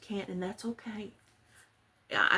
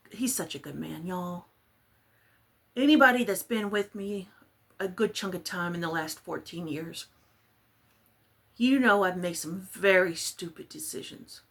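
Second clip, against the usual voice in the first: usual speaking voice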